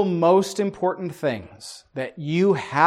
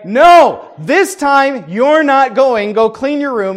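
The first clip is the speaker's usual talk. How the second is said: firm parental no; 7 semitones higher, 10 dB louder, slower than usual. Acted. Parent refusing crying kid.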